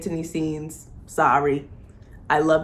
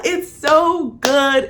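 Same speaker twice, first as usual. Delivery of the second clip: swooning gush; 8 semitones higher, 7 dB louder, much slower than usual. Real, not acted. Gushing over the confession scene.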